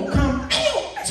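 high, hammed-up command; mock exorcism cry. Healer yelling into a deaf ear, mock command. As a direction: cry it out like a showy preacher. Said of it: "he'd go".

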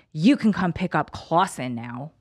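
ordinary talk, nothing marked

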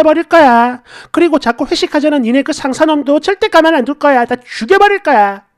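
acted furious outburst at a husband; angry yelling. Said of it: "이러면서 소리를 지르니까".

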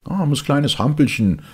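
high, loud pitying voice; pitying bystander. Acted. A bystander pitying the puppet, high and loud. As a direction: feel sorry for the little puppet. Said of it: "meinte einer".

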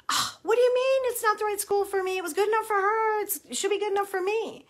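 put-on high, indignant teenage protest; whiny teen son. Mom mimics her son objecting, high and whiny. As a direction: pitch it way up like a put-out kid.

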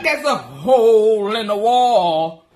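mock the husband by repeating slowly; slow mocking echo. Imitating husband repeating her news, slow with laughter. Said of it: "my husband like".

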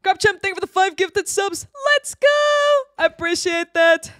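high, loud, excited shout-out; hyped thanks. Real, not acted. Hyped thanks for gifted subs.

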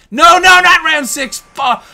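high, loud cry of protest; dismayed yell. Real, not acted. Gamer protesting the fight dragging on, loud dismay. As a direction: yell it like the round is lost. Fight goes to round six.

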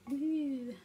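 normal talking voice

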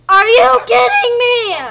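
loud, high cry of disbelief; yelled, high-pitched exasperation; exasperated outburst. Real, not acted. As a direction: shout it in exasperation.

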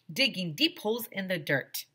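ordinary delivery, nothing marked

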